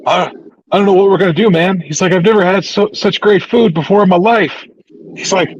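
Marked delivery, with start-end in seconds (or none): 0.1-1.8 high-pitched, exaggerated helplessness; hammed-up despair, voice pitched up; mock dismay. Retelling MJF's dismay at rivals. 2.1-4.6 high, overwrought retold amazement; hammed-up awe; mock dismay. Retelling MJF awed by food.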